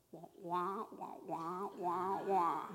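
low, wordless wah-wah imitation of a tuned-out adult; Charlie Brown's teacher. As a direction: drone low nonsense like a cartoon teacher. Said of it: "What Charlie Brown's teacher talks like".

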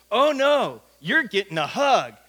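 loud, swooping "Oh, no"; emphatic insistence. Retold or imitated. Ashley refuses a handshake and insists on hugging, emphatic. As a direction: overrule the offer with cheerful force.

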